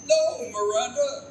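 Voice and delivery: pleading, high and loud cry; anguished cry. Acted. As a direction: cry out to stop her. Ghost father pleads with grieving daughter.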